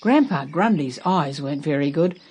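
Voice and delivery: usual speaking voice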